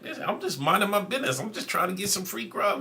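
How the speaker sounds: high-pitched innocent self-defence; defensive protest. Retold or imitated. Storyteller excusing himself to the lady, defensive. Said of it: "I'm like".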